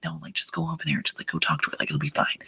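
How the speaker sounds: soft, whispered push to go over; hushed coaxing. Retold or imitated. Nudging a nervous friend to go talk, whispered. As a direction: whisper encouragement like a seventh grade wingman.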